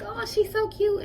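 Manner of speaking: high, slow cooing imitation of a stranger; gushing stranger. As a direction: coo over the baby like a passer-by. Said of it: "It's like".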